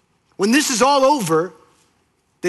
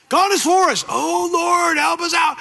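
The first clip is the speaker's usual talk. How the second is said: desperate plea; 9 semitones higher than usual. Acted. Moses pleading with God as Pharaoh's army approaches, desperate high shout. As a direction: beg the Lord for rescue.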